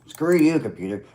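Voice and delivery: sudden loud, high snap at the computer; annoyed outburst. Real, not acted. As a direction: blurt it out in irritation. Snaps at frozen computer.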